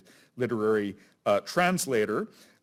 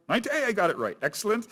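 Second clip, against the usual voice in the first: much higher, delighted at being right; pleasantly surprised; 11 semitones higher, much faster than usual. Real, not acted. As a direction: sound pleased you guessed correctly. Pleasantly finds the date was right.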